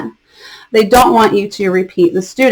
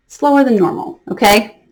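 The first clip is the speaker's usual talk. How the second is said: normal talking voice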